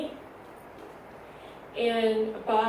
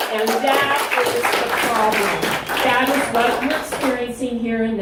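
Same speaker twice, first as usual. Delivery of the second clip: forceful, raised voice; emphatic loud; 9 dB louder than usual. Real, not acted. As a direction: hammer the point home to the board. Heated public comment to commission.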